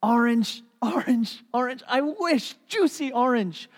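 loud, high, effortful bursts; straining apple tree. Acted. Rotten tree trying harder for juicy oranges, straining. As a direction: strain harder on every word, pushing it out. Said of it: "And he tried more".

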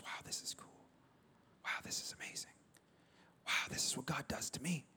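hushed whisper of wonder; awed whisper. Retold or imitated. Preacher imitating a grateful receiver, awed whisper. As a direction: breathe it out in quiet amazement.

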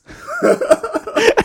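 high burst of laughter; loud laugh. Real, not acted. Laughing at own joke.

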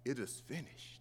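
soft, low, barely voiced; quiet final words. Jesus dying on the cross, whispering his last words. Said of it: "And Jesus said".

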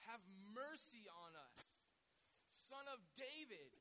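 slow, drawn-out pleading cry from the gut; intense cry. Shown as a demonstration. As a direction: stretch each word like a desperate plea. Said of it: "comes from the pits of their stomach".

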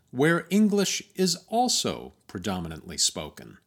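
usual speaking voice

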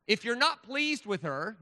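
loud, slow Southern preacher; Southern accent. Acted. Speaker resumes reading scripture aloud, in a big Southern accent. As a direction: proclaim it slowly in a Southern accent. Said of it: "read this is in a Southern accent".

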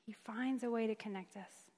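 hushed repetition. Shown as a demonstration. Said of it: "and he finds a way to connect us".

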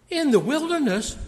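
In the wilderness? pitched high in disbelief; incredulous question. Retold or imitated. Preacher voicing his own surprise at the text, rising incredulous. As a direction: ask In the wilderness? as if shocked. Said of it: "And I thought to myself".

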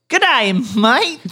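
hammed-up high Australian greeting; loud mock Australian hello; Australian accent. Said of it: "That was Australian".

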